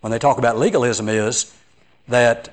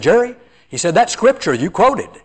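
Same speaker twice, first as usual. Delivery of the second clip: higher-pitched, insistent quote; intense retold urgency; 7 semitones higher than usual. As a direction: imitate a brother urgently buttonholing you. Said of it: "very intense".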